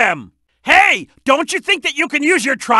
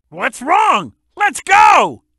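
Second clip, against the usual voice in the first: ordinary talk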